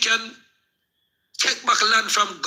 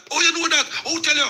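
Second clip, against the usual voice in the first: higher mock-amazed exclaim, imitating the people; surprised exclaim; 7 semitones higher than usual. Man mimicking the astonished villagers who heard him. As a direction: raise it like startled folks asking who told him.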